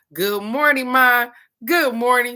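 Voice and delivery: loud, high call to her mom. Real, not acted. Greeting her mom as she joins the live, loud and high.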